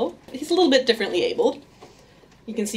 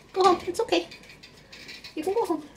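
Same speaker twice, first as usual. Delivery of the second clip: much higher, soft coaxing; 9 semitones higher, 6 dB quieter, much faster than usual. Real, not acted. Owner gently coaxing her grumpy rat. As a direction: gently coax the rat home.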